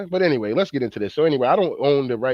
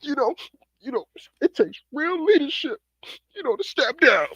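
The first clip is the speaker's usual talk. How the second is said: mocking Van Jones; 13 semitones higher than usual. Mocking Van Jones on Biden stepping down, high teary whine. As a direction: pitch way up and go all choked-up. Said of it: "Van Jones up there again".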